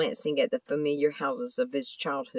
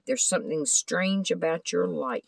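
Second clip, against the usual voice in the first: ordinary delivery, nothing marked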